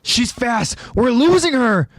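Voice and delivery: high-pitched alarmed shout; acted frantic warning; urgent call. A narrator's reading. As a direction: call out while giving chase. Calling out during the pursuit.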